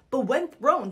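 ordinary talk, nothing marked

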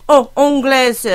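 bad British accent on French, thick in the nose; put-on English accent, heavy nasal French vowels; heavily nasal British-accented French. Shown as a demonstration. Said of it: "really, like, get heavy nasal on them".

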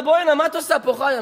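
acted call across to a child; calling her over. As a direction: summon your daughter sharply. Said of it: "קרא לה".

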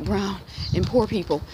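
ordinary talk

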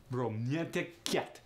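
slow Russian "romantic cat" with y-glides; Russian accent, "cat" said like "kyet"; Russian diphthong. Shown as a demonstration. [name] shows another variation, Russian diphthong. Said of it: "There's that diphthong".

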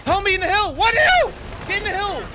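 very high, frantic team callouts; real high-pitched desperate shouting; shouting for help. Real, not acted. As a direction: yell urgently at your teammates.

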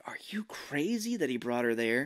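unvoiced urgent whisper. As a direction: hiss it in a whisper. Leonardo quoted.

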